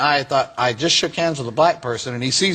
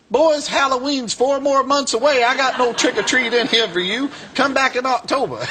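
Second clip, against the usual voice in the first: high mock-scolding reverend voice; imitated unbothered reverend; 9 semitones higher than usual. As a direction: mimic an old man airily sending trick-or-treaters away. Quoting the reverend brushing off Klansmen.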